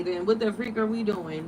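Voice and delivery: usual speaking voice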